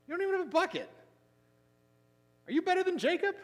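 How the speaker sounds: high put-on voice dripping with sarcasm; sarcastic woman. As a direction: sneer it up high like a doubting woman. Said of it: "she's already getting a little sarcastic with him".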